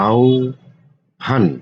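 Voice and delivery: আহ্বান said very slowly, sounds held long; drawn-out spelling. Shown as a demonstration. Teacher to students, slowly stretching আহ্বান. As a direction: linger on every sound of আহ্বান. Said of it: "teacher stretches word for students".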